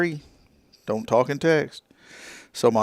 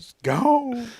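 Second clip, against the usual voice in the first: high wail of gone; mock lament; 13 semitones higher than usual. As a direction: parrot the ad's overdone sorrow. Joins in ad parody.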